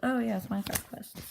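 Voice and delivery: ordinary delivery, nothing marked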